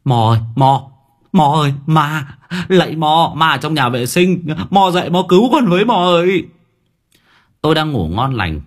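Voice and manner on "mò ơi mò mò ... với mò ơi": high panicked yelling, rushing at the end; terrified crying out. Acted. Student bursting into the room, calling for help in panic. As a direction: scream for mum like the ghost is right behind. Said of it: "bật điện kêu lên".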